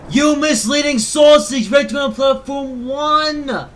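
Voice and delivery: loud, slow, high mock scolding; mock outrage. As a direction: bellow it in playful outrage.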